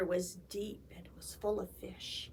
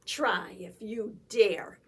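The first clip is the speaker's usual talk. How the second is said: loud defiant bellow; goat bellow; 2 semitones lower, 7 dB louder than usual. A narrator's reading. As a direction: bellow it right back. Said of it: "bellowed right back".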